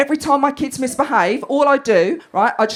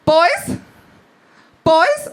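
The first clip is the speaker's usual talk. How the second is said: higher, rising mock call; mum telling off; 5 semitones higher, 3 dB louder, much slower than usual. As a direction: imitate a mum calling her boys to order. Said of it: "she's telling off her two boys".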